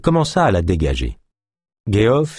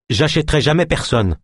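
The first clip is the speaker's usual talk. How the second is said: protesting; 7 semitones higher than usual. A narrator's reading. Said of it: "protesta De Lune".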